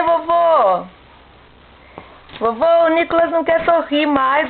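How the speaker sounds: calling across the house. Real, not acted. Calling grandpa to come.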